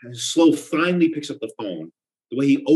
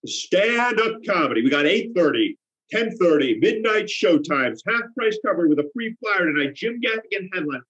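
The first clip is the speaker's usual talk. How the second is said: comedy barker; 6 semitones higher than usual. Acted. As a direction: hawk shows to passersby. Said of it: "I start barking".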